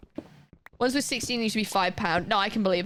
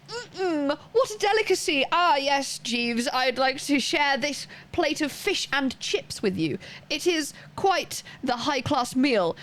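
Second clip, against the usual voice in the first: high, drawn-out aristocratic relish; mock posh gourmand; 5 semitones higher than usual. Streamer mocking fancy views of British food to viewers, hammed-up posh. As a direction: savour it like a pompous lord addressing the butler. Mocks Britons treating takeaway as fine dining.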